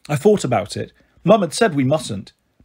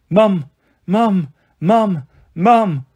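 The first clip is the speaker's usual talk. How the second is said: calling for Mum; 3 semitones higher, 4 dB louder, much slower than usual. Acted. Girl breaks down calling for mum.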